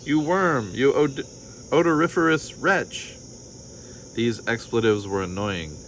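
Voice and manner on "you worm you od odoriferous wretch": high, indignant name-calling; pompous, sputtering contempt; reviling. A narrator's reading. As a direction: pile on insults with scorn. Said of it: "commenced to revile the fox".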